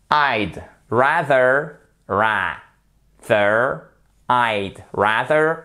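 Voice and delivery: "I'd rather" drawn out with a lengthened I'd; slow drill. Shown as a demonstration. Teacher drills French learners, slow and exaggerated. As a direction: work the mouth muscles on "rather". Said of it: "faire travailler les muscles de la bouche".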